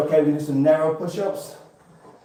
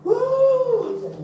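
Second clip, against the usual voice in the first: silly whoop. Pretend. Asked for silliness.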